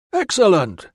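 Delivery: bright cheery exclamation. A narrator's reading. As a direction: sound pleased and upbeat. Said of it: "with more of Valens's characteristic cheeriness".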